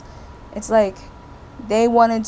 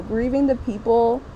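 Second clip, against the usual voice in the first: ordinary talk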